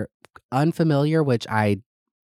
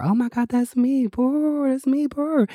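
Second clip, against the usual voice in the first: excited squeal. Retold or imitated. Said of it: "And then I see myself and I'm like".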